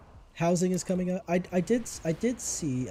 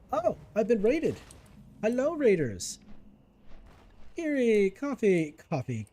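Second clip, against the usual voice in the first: excited greeting; 7 semitones higher, 4 dB louder than usual. Real, not acted. Greeting raiders who just arrived.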